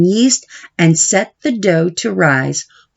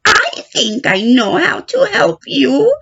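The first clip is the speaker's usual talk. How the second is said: put-on higher, gentle old witch voice; kindly wise witch; 12 semitones higher than usual. The wise witch Stregonona speaks.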